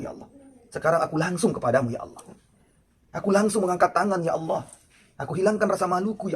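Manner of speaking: a sinner's desperate prayer, mildly voiced by the preacher; pleading prayer. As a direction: plead with God, rising on each line. Voicing Nasuha's tearful prayer.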